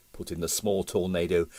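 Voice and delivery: ordinary delivery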